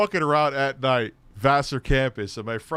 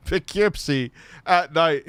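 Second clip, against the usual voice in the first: usual speaking voice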